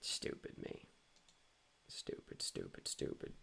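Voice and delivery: a hushed hiss of self-reproach; whispered self-scolding. Real, not acted. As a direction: whisper it at yourself, disgusted. Scolding himself after missing answer.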